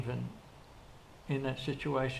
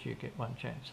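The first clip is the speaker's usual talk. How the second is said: softer repeat, you still leading; quiet repeat; 8 dB quieter than usual. Retold or imitated. Boss repeating his warning to the worker, quieter. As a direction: let the rule sink in, softly.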